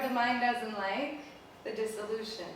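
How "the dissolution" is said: ordinary delivery, nothing marked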